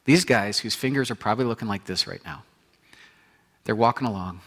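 normal talking voice

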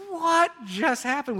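high, loud, slow astonishment; amazed. Acted. The crowd watching Lazarus walk out, astonished. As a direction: gasp it out in wonder.

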